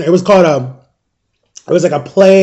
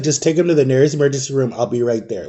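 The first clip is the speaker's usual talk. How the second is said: lower, softer, quick and matter-of-fact; calm mother; 4 semitones lower, 5 dB quieter than usual. Retold or imitated. Mom answers the emergency call, imitated calm. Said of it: "she was, like, calm about it".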